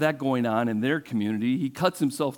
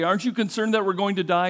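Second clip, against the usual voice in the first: mock scared disciples; 6 semitones higher, 3 dB louder, faster than usual. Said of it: "and they're scared".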